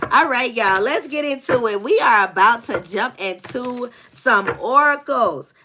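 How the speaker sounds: energetic tarot host rallying the chat; hype host. Acted. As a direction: pump the audience up to start. Hype host opens the reading.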